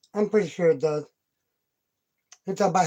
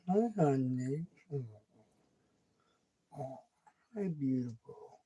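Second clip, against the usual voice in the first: hushed tender coo; 6 semitones lower, 10 dB quieter than usual. Real, not acted. Owner softly greeting her drowsy kitten, low and hushed. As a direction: murmur gently to the sleepy cat.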